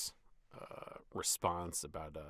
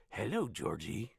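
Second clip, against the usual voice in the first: lightly voiced creepy clown greeting; Pennywise; 3 dB louder than usual. Shown as a demonstration. Voice actor does a clown impression, lightly creepy. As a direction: lure the boy in sweetly as the clown. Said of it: "My Pennywise goes into Scooby-Doo".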